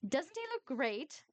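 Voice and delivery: slow, high, dreamy sigh; swooning. A narrator's reading. Miffy gazing at Dexter, swooning. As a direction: swoon over him. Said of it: "she sighed swooningly".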